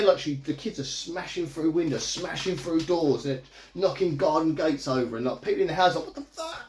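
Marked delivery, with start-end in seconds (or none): 6.1-6.6 high, hushed squeal of disbelief; mock shock. People in houses as kids smash through, high hushed shock. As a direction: squeak it, startled but held back.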